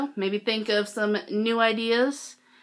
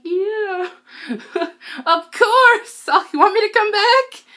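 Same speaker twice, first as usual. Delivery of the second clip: high, louder, laughing squeaky gush; giddy fan reply; 13 semitones higher, 8 dB louder, slower than usual. Retold or imitated. Fan answering idols as she leaves, giddy squeaky gush. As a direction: gush like a flustered fangirl. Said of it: "And I'm like".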